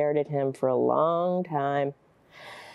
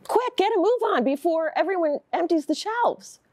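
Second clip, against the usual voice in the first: high-pitched, urgent order; urgent hurrying; 11 semitones higher than usual. Acted. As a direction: hurry a dawdling husband along. Wife hurrying husband to the shops.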